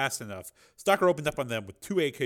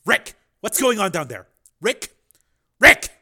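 much higher, louder shout into the phone; yelling; 10 semitones higher, 15 dB louder than usual. A narrator's reading. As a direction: yell for Rick, rising. Yelling into the intercom.

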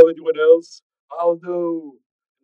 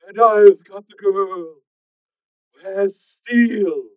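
slow, loud, hollow villain on the line; sinister caller's hollow voice; coarse, hollow phone voice; 9 semitones higher, 16 dB louder, much slower than usual. Acted. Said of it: "the coarse and hollow-sounding voice".

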